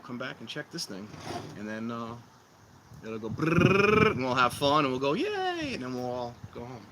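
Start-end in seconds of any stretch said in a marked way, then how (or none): 3.0-4.1 mock roar. Imitating the fire roaring. 5.1-5.7 high squeaky pretend Yay; a silly pretend cheer; mock cheer. Streamer joking about everyone cheering, high mock Yay.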